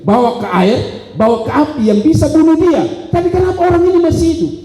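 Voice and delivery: high, driving sermon delivery; forceful preaching. Acted. Preacher urging the congregation to realise why he seeks God, forceful.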